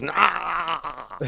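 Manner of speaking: high yelp of abandon; wild yell. Acted. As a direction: whoop it out wildly. Voicing a wild bad guy.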